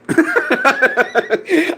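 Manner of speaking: high burst of laughter; high cackling laugh. Real, not acted. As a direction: crack up at the comment. Laughing at chat.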